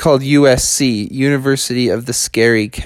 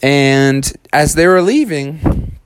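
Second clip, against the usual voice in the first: ordinary delivery, nothing marked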